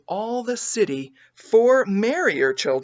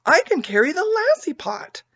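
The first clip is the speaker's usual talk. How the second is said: put-on much higher little girl, louder; proud; 12 semitones higher, 4 dB louder than usual. A narrator's reading. Little May proudly offering her pot. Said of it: "proudly giving the thing".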